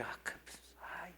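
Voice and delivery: soft breathless whisper of relief; hushed, almost voiceless gratitude; whispered awe. Retold or imitated. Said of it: "Y yo dije". (susurro conmovido)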